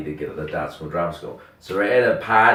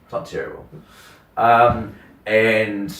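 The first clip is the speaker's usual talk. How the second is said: ordinary delivery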